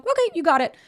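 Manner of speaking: high, loud okay in a retold reply; eager agreement. Woman answers her partner who is offline, high eager agreement. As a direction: jump up in pitch to agree readily.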